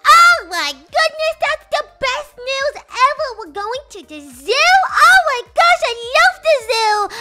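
put-on overjoyed kid. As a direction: burst out like a kid told the best news. Kid thrilled by zoo news.

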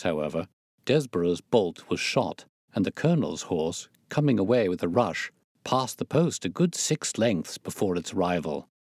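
usual speaking voice

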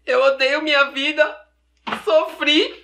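slow, hammed-up wail of self-pity; tearful misery. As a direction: break into comic crying. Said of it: "você tá rindo ou chorando".

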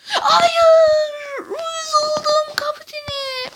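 a high, loud wail of real disappointment, slowing at the end; anguished cry. Real, not acted. Gamer groans at the captain after losing, anguished. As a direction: cry out as the chance slips away. Missed chance, real outburst.

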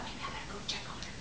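quick whisper to herself; whispered thought. Acted. Mother deciding to check on the bride, quick whisper. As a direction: whisper it fast, thinking aloud.

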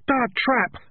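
the whole squad yells back; squad roar. A narrator's reading. Rats answering their sergeant, roaring.

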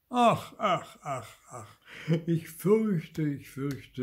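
wailing start that sinks and fades; dejected lament. A narrator's reading. Woodcarver regretting his wish, dejected wailing. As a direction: lament high, then let it die away. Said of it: "setzte sich ganz betrübt".

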